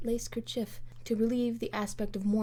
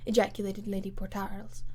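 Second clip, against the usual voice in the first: ordinary delivery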